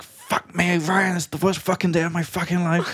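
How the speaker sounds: high, laughing mock despair; exasperated rant. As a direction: vent it like the worst day ever. Exasperated outburst after hours filming.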